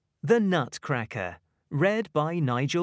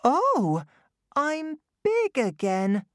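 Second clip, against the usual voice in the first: put-on high, bright child voice; little girl voice; 13 semitones higher than usual. A narrator's reading. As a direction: speak as young Clara. Clara speaks.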